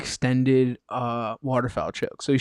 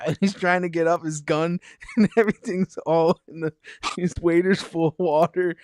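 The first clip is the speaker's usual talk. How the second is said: normal talking voice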